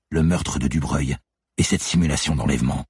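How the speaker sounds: usual speaking voice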